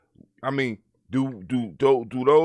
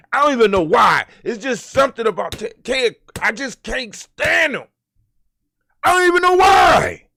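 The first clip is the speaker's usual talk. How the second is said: higher, louder, shouting in disgust; exasperated rant; 10 semitones higher, 7 dB louder than usual. Real, not acted. Vlogger shouting about Ted Cruz, exasperated. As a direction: yell out the dislike.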